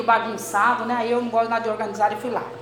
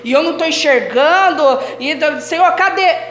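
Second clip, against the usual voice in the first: louder, higher desperate plea; blinded believer's cry; 5 semitones higher, 7 dB louder than usual. Acted. Preacher voicing a blinded believer seeking the Lord, loud plea. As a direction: cry out to God as if blind and searching. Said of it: "cego, deturpado".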